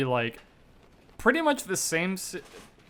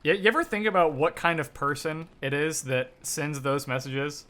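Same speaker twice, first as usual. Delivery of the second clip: normal talking voice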